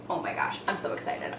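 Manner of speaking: breathless thrilled whisper; excited whisper. Real, not acted. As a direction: hush it out with glee. Thrilled whisper over Disney ears.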